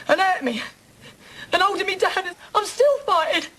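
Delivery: high, anguished wail with drawn-out words; distraught. Acted. Distraught account of being attacked.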